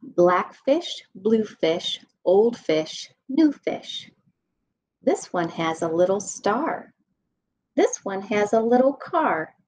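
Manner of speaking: lively sing-song read-aloud voice; animated bedtime story reading; reading to children. A narrator's reading. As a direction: read it bouncy for little kids.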